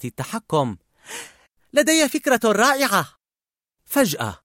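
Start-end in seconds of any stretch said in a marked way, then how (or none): 1.7-3.1 high, animated announcement; perky and much higher; excited idea. Acted. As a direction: burst out with an idea. Joy announces her bright idea.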